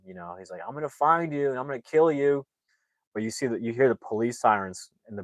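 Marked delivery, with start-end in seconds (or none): 0.7-2.5 retold yelling. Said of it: "He's, like, yelling".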